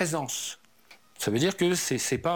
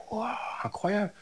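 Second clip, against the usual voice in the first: hushed wonder; 6 semitones higher, 4 dB quieter than usual. Retold or imitated. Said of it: "je fais".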